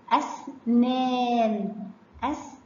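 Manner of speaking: "أسنان" as أس, pause, long-held نان; slow teacher's syllables on "أسنان"; sounded out. Shown as a demonstration. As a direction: join the silent س to the alef, then stretch نان. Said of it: "أنطقه مع الحرف اللي قبله".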